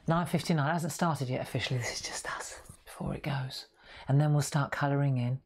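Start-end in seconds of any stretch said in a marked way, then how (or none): 1.8-3.7 conspiratorial whisper to the viewers. Real, not acted. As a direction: hush it like a secret before the show. Private aside before official start.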